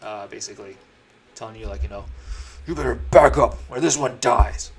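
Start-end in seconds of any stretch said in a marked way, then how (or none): 2.6-4.7 loud hostage threat with a sword up; villain shouting a threat while holding a hostage; threatening yell. Acted. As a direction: bark the threat at the party. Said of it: "Starts yelling".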